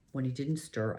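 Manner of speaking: plain reading voice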